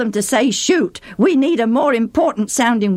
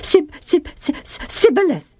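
higher, frightened stammer on "Sib-"; 7 semitones higher than usual. Acted. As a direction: stumble in terror over "Sibboleth". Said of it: "stammered the fugitive".

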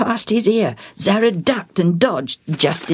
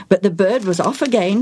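plain reading voice

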